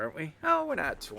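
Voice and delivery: ordinary delivery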